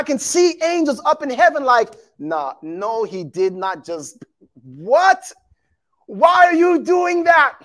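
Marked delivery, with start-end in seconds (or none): 2.2-4.2 low, softer stunned reaction; mock disbelief. Preacher voices angels watching from heaven, hushed mock disbelief. 4.6-7.6 high, drawn-out exasperated question; mock incredulity. Preacher voices angels up in heaven watching us, high incredulous outcry. Angels aghast at foolish humans.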